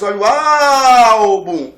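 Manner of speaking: very loud commentator cry, AL of "álbum" stretched; Galvão Bueno. Acted. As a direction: shout it like a match call, holding the stressed syllable. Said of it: "lembrar da narração do Galvão Bueno". (narração esportiva, "álbum" com o AL esticado)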